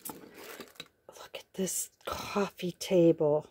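slow hushed whisper of delight; awed whisper. Real, not acted. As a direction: breathe it out in wonder. Awed unboxing, unvoiced.

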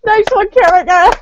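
excited. Real, not acted. Cheering after timing someone out.

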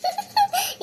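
gleeful high-pitched laugh. Acted. Gossiping woman laughing with glee to her friend. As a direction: burst into a delighted laugh.

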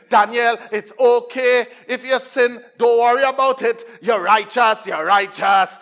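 loud, mocking preacher's reassurance; lightly put-on preacher proclaiming; mock false preacher. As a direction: preach false comfort loudly, as a send-up. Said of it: "he hearing preachers saying to him".

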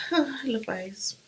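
slow soft whispered aside; hushed slow musing. Real, not acted. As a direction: breathe it to yourself. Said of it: "whispered aside".

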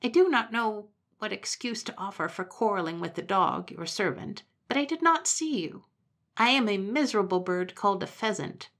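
a contrite pheasant begging to join. Acted.

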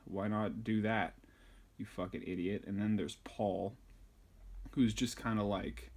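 normal talking voice

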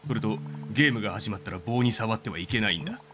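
lightly voiced game character. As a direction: read it as a slightly boastful kid. Reads the rival's rules.

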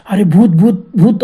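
loud acted cry of alarm; panicked yell. Four friends spot the ghost, acted panicked yell. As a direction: yell it out as a frightened warning. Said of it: "असं आम्ही चारी ओरडलो".

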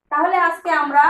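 ordinary talk, nothing marked

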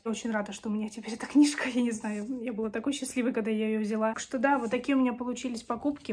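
ordinary delivery

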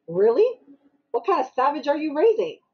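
loud, rising retold indignation; outraged challenge. Mother to the mother of the kid who hit her son, outraged. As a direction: challenge the other parent, high and punchy.

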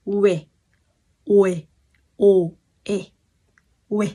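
quê, then split ô, e, then quê again; Korean sound quê. Shown as a demonstration. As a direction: break quê into ô and e. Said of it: "Đọc nhanh chữ ô với chữ e nó thành chữ".

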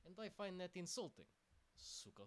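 hammed-up Russian accent, complaining to the mage; playful fake Russian accent with a grumbled Suka. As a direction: sulk about the insult in mock Russian accent. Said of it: "Buttface the Argonian is now Russian".

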